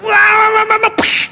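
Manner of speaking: high-pitched babbling tirade ending in a psh; hammed-up high wailing rage; mock angry outburst. Shown as a demonstration. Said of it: "Itu namanya marah".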